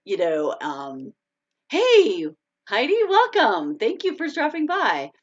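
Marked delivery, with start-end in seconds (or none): 1.7-5.1 high, sing-out hello; excited greeting. Real, not acted. Host welcoming a viewer who dropped by, excited greeting. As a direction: call out a delighted welcome, pitched well up.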